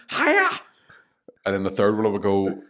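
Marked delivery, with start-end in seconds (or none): 0.1-0.5 high, loud hammed-up karate yelp; mock kung fu cry. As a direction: yelp it like a film fighter. Bruce Lee joke punchline.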